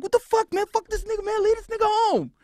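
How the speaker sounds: high, loud mock-furious crew voice; angry crew. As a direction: play the mad crew yelling to leave him home. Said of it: "These dudes are mad".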